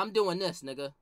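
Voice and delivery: ordinary talk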